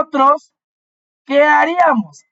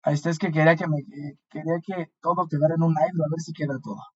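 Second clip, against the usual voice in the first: low, soft, half to oneself; muttered aside; 7 semitones lower, 9 dB quieter, much faster than usual. Real, not acted. Teacher to self while fixing the notes' layout, muttered aside. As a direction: drop the voice for a quick aside.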